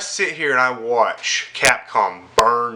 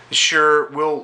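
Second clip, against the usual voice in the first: usual speaking voice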